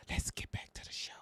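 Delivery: mocking hushed whisper; teasing stage whisper. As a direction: whisper it like a joke secret. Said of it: "she the one that's talking like that".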